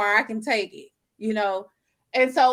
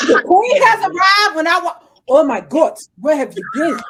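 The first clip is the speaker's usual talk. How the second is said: excited grand entrance; 6 semitones higher, 10 dB louder than usual. Retold or imitated. Retelling grand entrance at bar.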